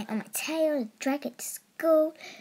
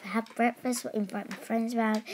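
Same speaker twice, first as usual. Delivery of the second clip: normal talking voice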